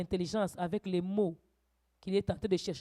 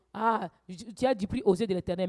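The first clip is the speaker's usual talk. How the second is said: usual speaking voice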